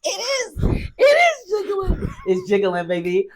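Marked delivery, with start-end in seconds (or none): 0.0-2.1 high laughing squeal; real giggly shout. Real, not acted. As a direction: shriek it through laughter. Laughing at friend's jiggling belly.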